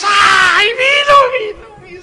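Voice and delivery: very high mock shrieking crowd; hammed-up crying amazement, loud; ecstatic crowd. Crowd sees water turn to wine. (la gente gritando emocionada, muy aguda)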